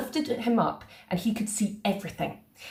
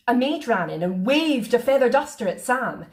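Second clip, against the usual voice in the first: ordinary delivery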